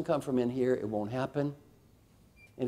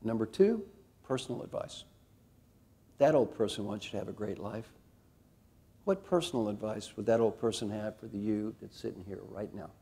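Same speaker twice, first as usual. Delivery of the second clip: hushed guided visualization; guided reflection; 2 semitones lower, 4 dB quieter than usual. Acted. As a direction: lead them inward gently.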